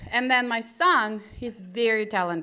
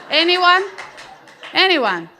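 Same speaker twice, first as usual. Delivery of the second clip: loud challenge; 7 semitones higher, 8 dB louder than usual. Retold or imitated. Mom's mock challenge to audience.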